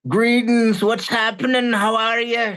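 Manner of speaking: high character hello; put-on host voice. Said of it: "my summit voice 'cause it's not my normal speaking voice".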